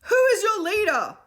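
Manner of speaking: put-on boy demanding obedience from the children. A narrator's reading. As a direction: press the crowd for an answer. Will pressing Myron.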